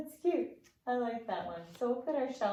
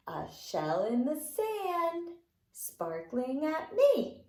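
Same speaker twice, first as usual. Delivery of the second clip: sing-song rhyme voice; children's fingerplay rhyme; 5 semitones higher, 4 dB louder, slower than usual. Acted. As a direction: lilt it for toddlers. Repeats beach fingerplay.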